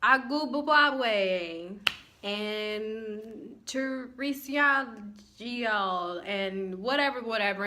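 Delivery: slow, botched name reading; mock slow roll call; mangled names. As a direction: drag out each name like a bad roll call. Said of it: "she's like".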